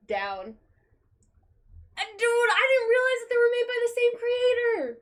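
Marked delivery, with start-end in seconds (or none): 2.0-5.0 high, louder and fast rush of excitement; excited realization. Real, not acted. Vlogger realizing both series share a creator, excited and high. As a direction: blurt it out amazed.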